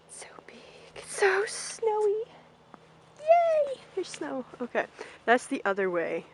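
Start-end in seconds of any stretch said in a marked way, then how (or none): 0.9-4.4 high, drawn-out delight; excited. Real, not acted. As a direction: squeal over the snow. Delighted by the snowy field.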